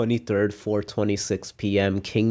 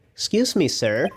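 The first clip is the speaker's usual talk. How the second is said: put-on lightly raised young boy voice; a polite kid calling out; a hint of a boy's voice; 4 semitones higher than usual. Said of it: "give him a normal kid voice".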